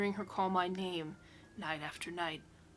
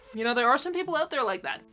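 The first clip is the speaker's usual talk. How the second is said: ordinary delivery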